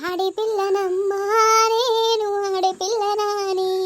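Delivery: lament. Acted. Captive woman laments her fate.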